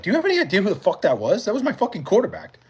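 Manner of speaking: high, rapid-fire mock outrage; incredulous retort. Guy telling the girl that was his quarterback, incredulous.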